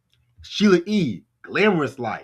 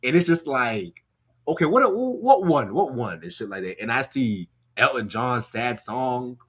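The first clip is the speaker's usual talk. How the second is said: normal talking voice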